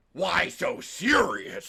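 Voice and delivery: slow, loud announcer growl; half devil, half Joker, drawn out. Acted. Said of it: "my announcer voice is".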